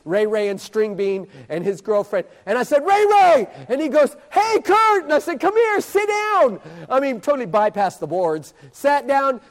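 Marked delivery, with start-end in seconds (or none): 2.8-3.5 loud, high-pitched holler; calling out. Retold or imitated. Preacher spots Ray Ray at the fiesta, hollering out. 4.3-5.0 high, loud call back; hollered greeting. Retold or imitated. Boy at the fiesta greets the preacher, hollering back. 5.4-6.6 high, drawn-out summons; calling over. Retold or imitated. Preacher calls Ray Ray over to sit, high drawn-out holler.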